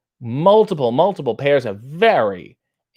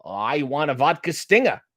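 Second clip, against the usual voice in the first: Boston accent, done as a demo on request; 2 semitones higher than usual. Shown as a demonstration. Answering a viewer's request for a good accent, in Boston accent. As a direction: put on a broad Boston accent. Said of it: "a good Boston accent".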